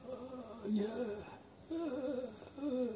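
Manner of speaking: put-on high, soft drunk groaning; the drunk sailor answers if it is his boat, dazed; dazed drunk mumble. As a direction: mumble like a man just fished out drunk. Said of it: "sobered up and done one".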